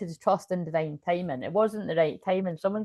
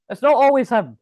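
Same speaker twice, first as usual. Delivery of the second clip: high, loud, insistent It's not always him; stressed protest; 6 semitones higher, 9 dB louder than usual. Real, not acted. To Barbie, exasperated insistence, higher and louder. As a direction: push back hard on not always. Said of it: "I have to just stress".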